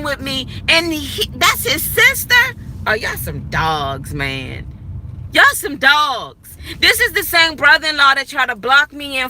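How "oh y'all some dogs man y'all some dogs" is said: low, dragged-out disgust at the men. Retold or imitated. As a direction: drop it low like a fed-up verdict.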